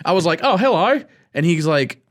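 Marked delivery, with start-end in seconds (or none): 0.4-1.0 higher, louder mock Australian greeting; Australian accent. Man recalls greeting his Australian coworker, mock Australian accent. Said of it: "I did an Australian accent".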